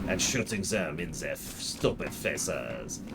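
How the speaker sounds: put-on low-key comic French-meets-German accent; bad French-German accent. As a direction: drag it out in a terrible Franco-German accent. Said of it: "bad French accent combined with a bad German accent".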